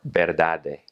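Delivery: "verdade" ending in a hard "deh"; continental Portuguese pronunciation. Shown as a demonstration. Teacher contrasting Portugal with Brazil, continental pronunciation. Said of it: "In continental Portuguese".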